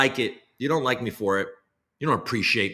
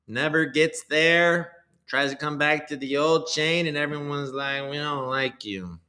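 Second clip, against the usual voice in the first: high put-on coin dev voice; 4 semitones higher than usual. Streamer parodying a crypto dev's new year message, high and slow. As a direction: ham up the goofy developer persona, stretched out. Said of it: "spouting off in that voice".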